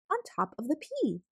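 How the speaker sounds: usual speaking voice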